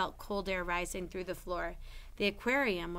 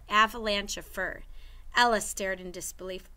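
plain reading voice

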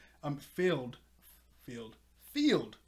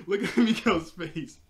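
laughing; 4 semitones higher, 6 dB louder, slower than usual. Real, not acted. Laughing at Miko's profile picture.

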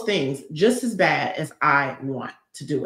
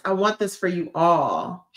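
ordinary delivery

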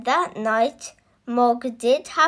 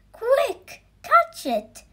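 much higher, urgent call; urgent shout; 12 semitones higher, 3 dB louder, slower than usual. Acted. The nurse urging everyone to catch the cat, shouting. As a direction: yell it out to grab the cat. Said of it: "shouted the nurse".

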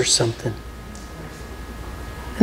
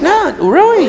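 mock surprise; 12 semitones higher than usual. Mock surprise at his answer.

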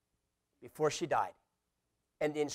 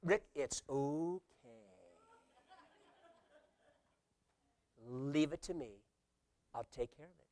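gentle voice of God; 10 dB quieter than usual. Acted. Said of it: "hear him whisper these two magical words".

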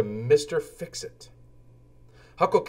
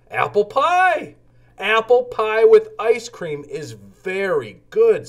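high, louder, slow gleeful cry; excited; 9 semitones higher, 5 dB louder than usual. Acted. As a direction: savour the thought of dessert. Lowly thinks of apple pie.

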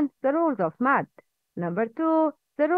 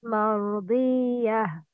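Quran recitation. Acted. Teacher recites Quran before lesson.

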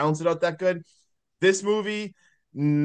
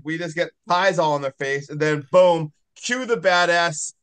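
usual speaking voice

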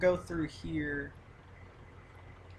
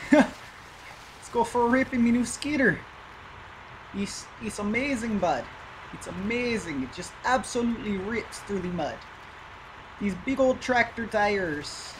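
put-on Canadian accent; 5 semitones higher than usual. Gamer praises his skidder's mud-ripping in a hammed-up Canadian accent. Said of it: "That's my, uh, Canadian accent".

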